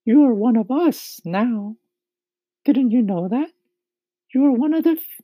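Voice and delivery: high ladybug voice, reassuring; kindly ladybug. A narrator's reading. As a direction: kindly comfort in a high-pitched voice. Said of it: "the ladybug said kindly".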